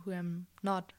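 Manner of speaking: ordinary talk, nothing marked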